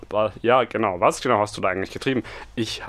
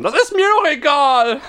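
high, loud tantrum shout; mock furious yelling; shrill tantrum; 8 dB louder than usual. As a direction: throw a screaming fit. Tantrum continues.

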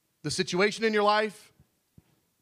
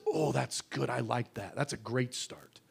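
much softer, lower, tender reply; gentle God; 9 semitones lower, 8 dB quieter than usual. Acted. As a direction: answer kindly, like a loving father. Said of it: "He says".

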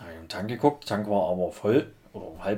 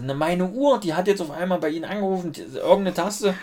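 flustered explaining; 9 semitones higher than usual. Retold or imitated. Own explanation retold.

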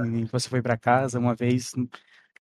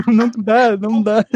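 laughing; 8 semitones higher, 8 dB louder than usual. Real, not acted. Laughing at choking on blood.

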